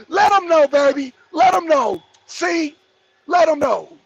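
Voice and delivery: loud, high hype yell, 'let 'em know'; hyped shout. Real, not acted. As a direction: cheer it on at full volume like a hype man. Hyping up a chat comment.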